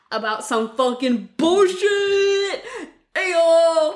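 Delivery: slow, high, excited yell; hyped punchline. Real, not acted. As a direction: land the joke with a shout. Punchline comeback, loud and slow.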